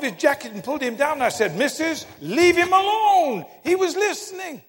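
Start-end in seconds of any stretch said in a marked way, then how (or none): 1.6-4.6 calling out. Retold or imitated. Preacher to the boy's mother pulling him down, calling out. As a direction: call across the church to stop her.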